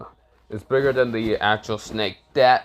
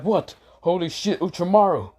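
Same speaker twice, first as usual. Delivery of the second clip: shocked; 8 semitones higher than usual. Acted. Everyone was like.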